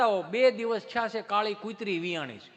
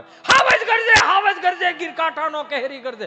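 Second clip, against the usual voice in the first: teacher's roaring recital; 8 semitones higher, 12 dB louder than usual. Retold or imitated. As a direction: roar the poem like a thundering teacher. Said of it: "સાહેબ આટલી ગર્જના કરે છે".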